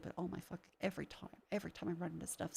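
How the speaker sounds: real frustrated mumble; exasperated mutter. Real, not acted. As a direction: grumble it under your breath. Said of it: "I'm getting upset".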